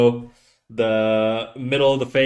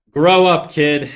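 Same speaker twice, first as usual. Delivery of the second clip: loud, raised playful telling-off; higher, punchy dismissal; mock scolding; 3 semitones higher, 7 dB louder, slower than usual. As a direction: shoo a kid off with mock sternness. Mock-banishing an underage viewer.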